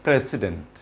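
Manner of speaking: "প্রেসিডেন্ট" with an s and a full "dent"; wrong pronunciation. Shown as a demonstration. Teacher shows learners' habit, mispronunciation. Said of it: "আমরা বলি".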